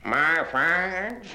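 ordinary talk, nothing marked

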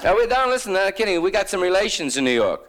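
high-pitched, chatty small-towner; eager local. Acted. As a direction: gush it high, as an imitated local. Voices the eager older couple.

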